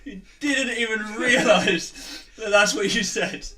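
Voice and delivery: high, slow, weeping laugh; crying with laughter. Real, not acted. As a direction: laugh till tears come.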